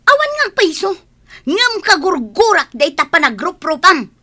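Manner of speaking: loud, high-pitched indignation; indignant outburst. Acted. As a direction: protest loudly with heated dislike. Voicing dislike in acted quarrel.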